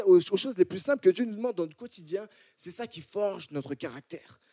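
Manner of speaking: ordinary delivery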